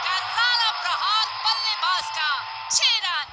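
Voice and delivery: loud, high call of a six; excited shout. Acted. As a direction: belt out the six like live commentary. Commentator calls a six.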